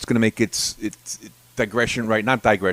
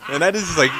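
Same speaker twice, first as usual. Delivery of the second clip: usual speaking voice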